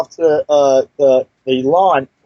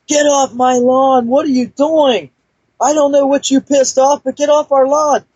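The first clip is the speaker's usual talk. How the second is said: put-on much higher, shouted at a kid on his lawn; angry old neighbor yelling; 10 semitones higher than usual. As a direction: bark at the trespasser in a high, strained old man's yell. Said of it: "opens up the screen door and says".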